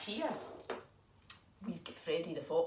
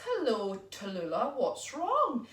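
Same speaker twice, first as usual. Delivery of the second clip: louder, booming hint of a kindly king; lightly voiced spider king; 2 semitones higher, 7 dB louder, slower than usual. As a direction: greet her grandly as the king of spiders. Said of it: "And he says".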